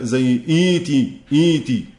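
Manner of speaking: "إيتي" said slowly, the long i drawn out; pronunciation demo. Shown as a demonstration. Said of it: "ما قلنا بعد همز الوصل زي". (نطق "إيتي" ببطء مع مد الياء)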